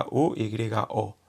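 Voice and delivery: ordinary talk, nothing marked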